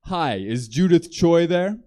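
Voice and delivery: a hint of an officious phone voice; hospital caller. Hospital calling the boyfriend, lightly voiced caller.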